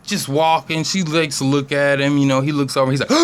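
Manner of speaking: ordinary delivery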